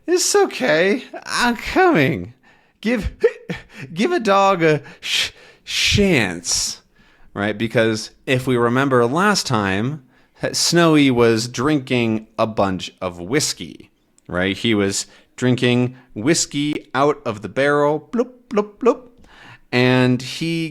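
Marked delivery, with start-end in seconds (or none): 0.1-6.8 put-on high, loopy drunk dog with a laugh; tipsy dog voice. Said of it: "But Snowy says". 18.1-19.1 gulping sounds. Retold or imitated. Imitating drinking sounds.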